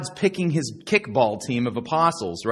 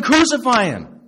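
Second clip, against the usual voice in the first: loud, high imitated mob cry; crowd yelling; 8 semitones higher, 8 dB louder than usual. Crowd demanding the crucifixion, imitated yelling. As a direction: yell it out like one of the mob. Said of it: "They were yelling".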